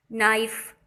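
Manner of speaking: नाइफ starts on n, k silent; teacher models नाइफ for the class. Shown as a demonstration. नाइफ बिना के. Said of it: "के नाइफ नहीं पढ़ेंगे".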